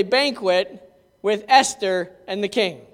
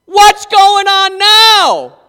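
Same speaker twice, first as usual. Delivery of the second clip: slow, much higher, loud outburst; furious shout; 8 semitones higher, 11 dB louder, much slower than usual. Acted. King finds Haman in the queen's lap, slow furious shout. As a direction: roar at the scene you walk into.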